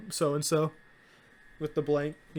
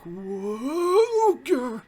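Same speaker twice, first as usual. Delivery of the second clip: awestruck whoa; 8 semitones higher, 5 dB louder than usual. Retold or imitated. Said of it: "And you're like".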